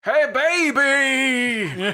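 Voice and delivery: high, very drawn-out comic call; Henry voice. Acted. Disguised character calling to an enemy, high drawn-out call. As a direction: call out slowly in a hammed-up character voice. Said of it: "I have to do a Henry voice".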